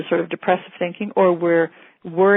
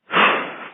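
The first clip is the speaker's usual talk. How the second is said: demo calming sigh. Shown as a demonstration. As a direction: breathe out audibly to show how to release. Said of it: "And take a breath".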